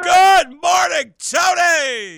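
loud, high, slow stretched shout; shouted greeting. Real, not acted. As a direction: belt out the greeting.

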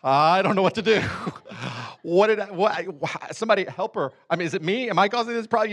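put-on loud, high, frantic voice of a panicking young man; flustered panic. Pastor recalls panicking as fiancée cries, loud frantic panic. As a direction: blurt it out helplessly.

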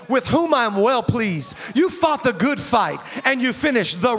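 loud, rousing sermon climax; full-force preaching. Real, not acted. Pastor rallying church not to quit, loud rousing preaching. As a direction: drive each phrase home to stir the crowd.

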